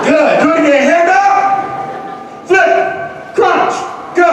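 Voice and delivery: high, punchy drill commands; calling out commands. Real, not acted. Speaker leads audience in a hand drill, calling out high. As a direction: bark short orders to a crowd.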